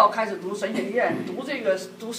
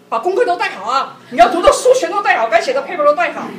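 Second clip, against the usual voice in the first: raised, higher voice pushing him to pack his books; nagging reminder; 6 semitones higher, 9 dB louder than usual. Retold or imitated. Wife reminding husband before vacation, loud nagging. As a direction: call it out like a bossy wife checking the bags.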